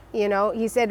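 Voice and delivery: ordinary talk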